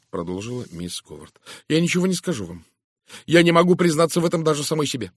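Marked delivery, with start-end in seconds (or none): 1.7-5.1 high, louder, hurried protest; agitated refusal. A narrator's reading. Miss Howard won't admit it even to herself, agitated refusal.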